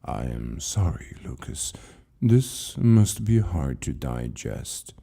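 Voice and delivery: an ancient librarian speaking with patient calm; calm old keeper. Acted. Keeper calmly answers frightened Lucas, low and slow. Keeper consoles then departs.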